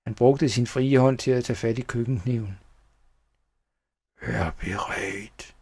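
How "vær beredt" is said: slow, low, hushed threat; menacing whisper. A narrator's reading. Schnickels readying the kitchen knife, menacing whisper. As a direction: whisper it softly, stretching the words. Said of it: "hviskede han".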